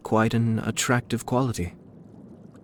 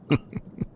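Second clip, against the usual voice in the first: soft chuckle; 4 dB quieter than usual. Acted. Quiet amused chuckle.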